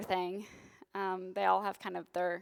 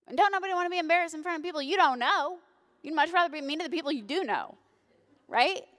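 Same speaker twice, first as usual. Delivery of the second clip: high, fast comic commentary; sassy aside; 7 semitones higher, 5 dB louder, faster than usual. Real, not acted. Pastor jokes to congregation, high and sassy. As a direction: rattle it off with attitude.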